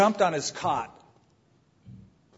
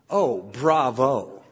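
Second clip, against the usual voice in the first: sarcastic; 3 semitones lower, much slower than usual. Retold or imitated. Sarcastic reply to boast.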